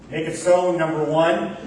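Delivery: hint of a starship captain's command; Captain Picard. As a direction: give the order like a calm captain on the bridge. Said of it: "proclaimed her like Captain Picard".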